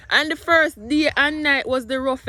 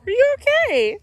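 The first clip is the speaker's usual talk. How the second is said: high, loud mock-worried question; mock-concerned; 14 semitones higher, 7 dB louder than usual. Mom asking viewers in a hammed-up worried voice. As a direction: ham up the concern. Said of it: "Me have to talk like this from now on".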